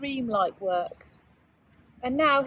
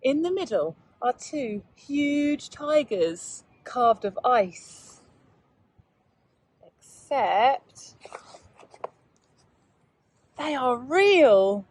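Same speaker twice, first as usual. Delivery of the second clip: slow, hushed storybook reading; gentle bedtime-story telling; story narration; slower than usual. A narrator's reading. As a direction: draw young listeners into the tale. Teacher reads picture book aloud.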